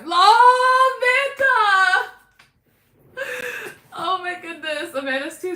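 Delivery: high, loud delighted shout; excited. Real, not acted. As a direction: cry out her name happily. Thanks donor excitedly.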